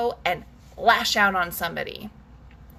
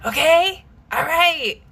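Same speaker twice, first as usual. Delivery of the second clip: usual speaking voice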